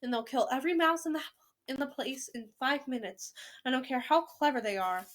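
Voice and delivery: plain reading voice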